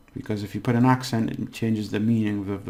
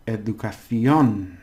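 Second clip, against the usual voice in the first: "educación" slow, with a loud long CIÓN; stress on final syllable; 5 dB louder, slower than usual. Shown as a demonstration. Teacher points out the on, final syllable of "educación" stressed. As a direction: land hard on the end of "educación". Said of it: "On, you see, on there".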